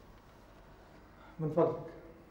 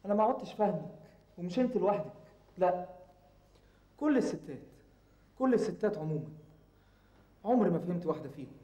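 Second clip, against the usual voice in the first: high, agitated protest to mother; raised voice; 8 semitones higher than usual. Acted. Exasperated son asks mother about Rowaida, raised voice. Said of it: "وطي صوتك".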